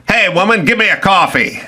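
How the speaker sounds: mock gumshoe ordering coffee; bossy radio husband. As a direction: command her like a thirties tough guy. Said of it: "he'd go".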